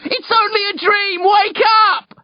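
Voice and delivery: high, desperate yell; frantic high-pitched shout; urgent shouting. A narrator's reading. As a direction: shout to wake a sleeper. Shouting to wake frantic uncle.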